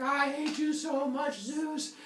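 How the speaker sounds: high, drawn-out mock yell. Woman yells at Zeus while running, high yell. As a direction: yell it slowly, high and hammed-up. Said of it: "she's yelling at Zeus as she's running".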